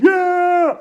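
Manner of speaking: high, loud, held-out cheer; big imitated group "yeah"; acted crowd cheer. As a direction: cheer like a happy crowd of players. Crowd cheering after a game.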